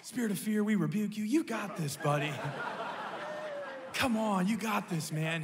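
playful preacher rebuke, pitched high; hammed-up spiritual pep talk; dad half-jokingly rebuking fear over his son, high and eager; mock rebuke. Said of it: "you know I'm an encourager".

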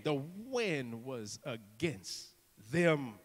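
slow, dropping low and soft; hushed, lingering emphasis; dramatic slowdown. Real, not acted. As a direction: draw each word out, sinking away. Preacher slows for dramatic effect.

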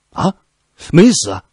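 high, loud astonished gasp; shocked surprise. A narrator's reading. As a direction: cry out in disbelief. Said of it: "颜如山一惊".

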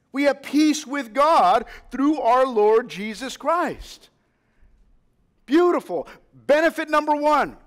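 building, insistent pulpit delivery; preaching. Acted. Pastor rousing young believers about eternal life, fervent preaching. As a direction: drive home "forever" to the church.

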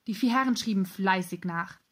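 ordinary delivery

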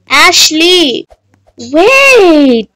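loud, exasperated call. Real, not acted. Player calls after Ashley walking off, calling out. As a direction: shout after someone getting away.

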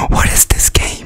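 hushed unvoiced whisper. Real, not acted. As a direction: breathe it as a secret. Same question whispered after.

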